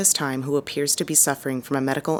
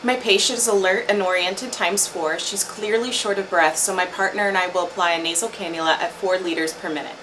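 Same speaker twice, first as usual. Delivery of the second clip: ordinary talk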